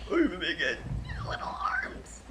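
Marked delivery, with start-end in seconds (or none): 1.3-2.2 high, breathy squeak; tiny T-rex whisper. Pretend. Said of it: "Tyrannosaurus Rex".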